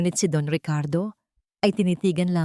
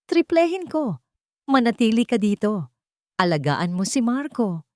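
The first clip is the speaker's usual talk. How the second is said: firm command; 5 semitones higher than usual. Acted. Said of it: "Isa itong utos".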